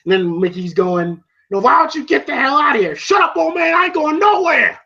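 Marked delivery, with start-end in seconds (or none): 1.5-3.0 higher, quick mock outburst; angry retort. Mickey to the intruding challenger, mock angry retort. 3.0-4.8 high mock shout; defiant shouting. Challenger refusing Mickey's order, defiant mock shout.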